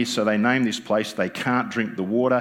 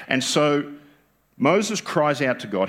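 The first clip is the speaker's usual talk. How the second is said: ordinary delivery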